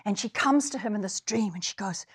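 ordinary talk

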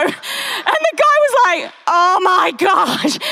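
high, slow, drawn-out oh my gosh; mortified. Retold or imitated. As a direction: stretch it out in horror. Embarrassed man's reaction.

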